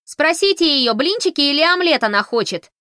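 loud, high call from afar; yelling. Acted. Fanny yells breakfast choices from the kitchen. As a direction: shout across the house. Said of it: "заорала с кухни Фанни".

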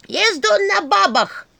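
very high, loud drawn-out insult; snapping back. A narrator's reading. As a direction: screech the retort in a staff's voice. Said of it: "огрызнулась Черепуша". (огрызаясь, высоко и громко)

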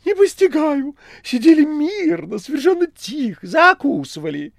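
very high, loud bewildered outcry; exclaiming. A narrator's reading. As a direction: protest innocence loudly. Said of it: "Бегемот вскричал". (возмущённо вскричал)

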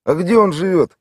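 lightly voiced rough local man; Tolik's voice. A narrator's reading. Narrator voices Tolik's question.

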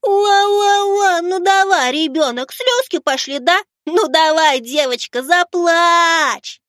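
put-on very high, taunting baby whine; mock whimpering. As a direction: tease a child into crying in squeaky singsong. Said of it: "захныкал я".

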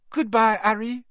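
put-on high, light French-accented voice. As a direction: speak like a French girl. Fleur speaking.

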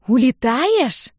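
high, drawn-out incredulous wail; loud wailed question; outraged cry. A narrator's reading. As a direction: cry it out in slow disbelief. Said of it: "возопила она".

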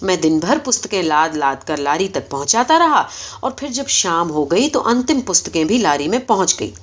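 plain reading voice

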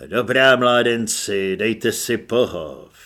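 slow, low, loud sergeant's call. A narrator's reading. Sergeant calling recruits to stand at ease. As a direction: boom it low and unhurried to the lads. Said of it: "zvolal Honzárum".

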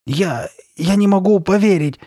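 high, loud, stammered exclamation; exclaiming. A narrator's reading. Girl refusing to believe the shopkeeper, exclaiming in disbelief. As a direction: cry out in disbelief. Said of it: "воскликнула Карли Бет".

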